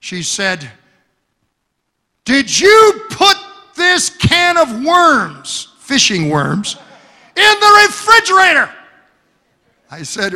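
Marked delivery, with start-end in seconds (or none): 2.2-8.7 high, loud shrill-wife imitation; mock the wife's outraged shriek, much higher and louder; wife yelling. Preacher imitating his wife yelling about the worms in the fridge, high and loud. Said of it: "my wife yelled at me".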